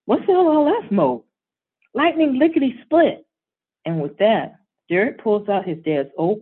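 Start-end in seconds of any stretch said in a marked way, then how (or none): 0.0-1.2 higher, louder nervous question; fearful. Acted. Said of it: "Dad, looking fearful". 1.9-3.3 high, loud, gleeful answer; proud kid explaining; excited boy. Acted.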